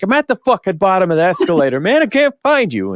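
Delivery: high, fast, frantic retelling; exasperated phone retort. Retold or imitated. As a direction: re-enact a frantic, rising protest down the phone. Frantic phone exchange while lost.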